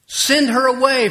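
high, loud plea to send her off; exasperated disciples. Retold or imitated. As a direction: shove the plea out urgently. Disciples begging Jesus.

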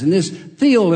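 normal talking voice

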